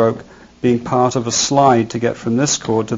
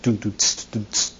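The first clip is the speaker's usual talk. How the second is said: soft, quick mouthed hi-hat shots; beatbox the drummer's hi-hat lightly; hi-hat imitation; 3 semitones lower, 5 dB quieter, faster than usual. Shown as a demonstration. Teacher imitating a drummer's hi-hat, soft and quick. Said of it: "sound like shots drummers make on the hi-hats".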